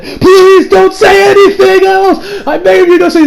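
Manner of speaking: very high, drawn-out begging; hammed-up squealing plea, loud and stretched; desperate plea. Retelling the boy begging them to say nothing, mock desperate plea.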